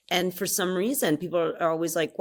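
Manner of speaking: ordinary talk, nothing marked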